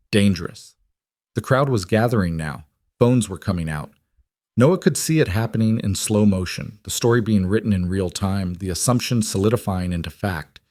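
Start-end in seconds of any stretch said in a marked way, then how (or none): none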